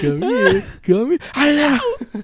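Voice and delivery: high, loud playful coaxing; playful teasing. Real, not acted. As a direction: coax them closer, giddy. Teasing tickler.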